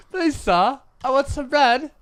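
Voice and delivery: high, loud, posh-sounding mock British; mock British accent. Shown as a demonstration. Trying his British accent on his friend, hammed-up. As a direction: ham up a stuffy Englishman. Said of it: "my British one".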